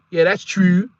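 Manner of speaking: imitated Baltimore accent, fronted vowel in 'true'; mock Baltimore accent. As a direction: tease the Baltimore way of saying 'true'. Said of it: "That Baltimore accent from a female".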